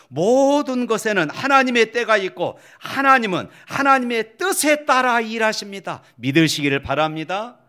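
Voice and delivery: high, loud sermon delivery; impassioned preacher driving the point home; full-force preaching. Real, not acted.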